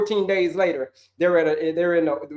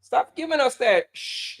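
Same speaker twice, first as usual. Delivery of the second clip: higher, slow, exasperated plea; the body pleading; 6 semitones higher, slower than usual. Pretend. Coach voicing the body scolding its owner, exasperated. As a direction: beg like a fed-up body. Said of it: "But your body's like".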